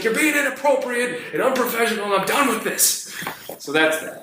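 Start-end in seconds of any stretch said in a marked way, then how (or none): 0.0-3.1 high, strained indignation; re-enacted angry telling-off. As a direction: lay down the line, fed up and pitched up. Re-enacts furious argument with coworker.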